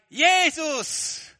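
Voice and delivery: loud, very high cry of the name; shout. Acted. Beggar in the children's Bible shouting for Jesus. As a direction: scream it from a red face. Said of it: "und schreit".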